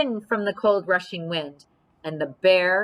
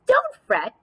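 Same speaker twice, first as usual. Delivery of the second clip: very high squeaky mouse voice; tiny high squeak of comfort; 3 dB louder than usual. Acted.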